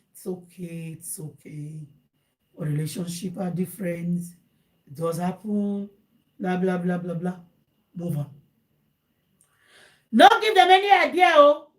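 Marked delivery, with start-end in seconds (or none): 0.1-1.9 very soft, low soothing; comforting. Acted. Friend comforting the troubled one, soothing. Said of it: "comfort them". 10.1-11.7 high, loud cry of caution; emphatic warning. Real, not acted. Host warning would-be advisers, loud and emphatic.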